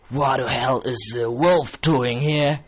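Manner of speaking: a hint of an indignant father, slow; father shocked at the wolf in bed; startled father.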